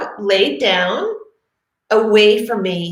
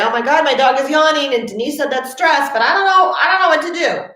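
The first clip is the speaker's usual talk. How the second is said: panicked owner; 7 semitones higher than usual. Pretend. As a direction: play the flustered owner who doesn't know what to do. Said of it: "there'll be a time when you say".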